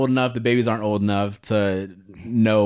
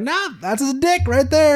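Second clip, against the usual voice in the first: high casual nurse voice. Retold or imitated. Quoting ultrasound tech again.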